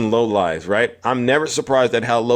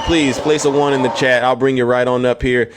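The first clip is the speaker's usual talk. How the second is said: ordinary delivery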